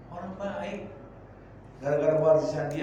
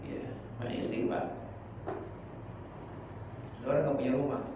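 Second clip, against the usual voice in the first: ordinary delivery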